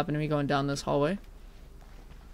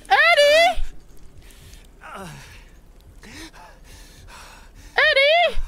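very high, loud shriek; mock anguished scream; 3 dB louder, slower than usual. Player calling for dead Eddie, mock anguished scream. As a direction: scream the name in hammed-up horror.

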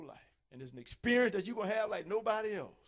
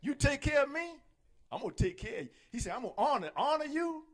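higher, louder promising voice; God promising; 6 semitones higher, 4 dB louder than usual. Retold or imitated. God promising his servants, rising and proud. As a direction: proclaim it as God's promise. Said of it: "God is saying this".

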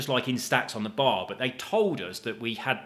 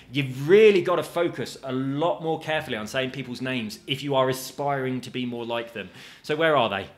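ordinary delivery

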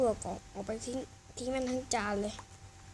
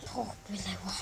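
puzzled whisper; 4 semitones lower, 6 dB quieter than usual. Acted. Son puzzled by dad meditating.

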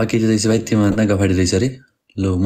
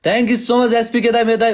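higher, eager thank-you; gushing thanks; 11 semitones higher than usual. Real, not acted. Streamer thanking SPK dai for a donation, gushing. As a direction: squeal it out to the donor.

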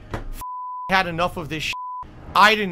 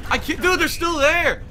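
exasperated; 9 semitones higher, 5 dB louder than usual. Real, not acted. Exasperated at intruders.

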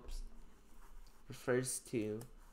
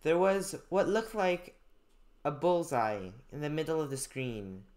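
clear, louder classroom reading of a passage; reading aloud; 2 semitones higher, 5 dB louder than usual. Acted. Teacher reading a story passage to students, clear read-aloud.

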